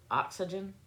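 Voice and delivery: usual speaking voice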